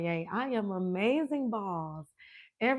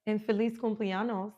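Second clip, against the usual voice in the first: ordinary delivery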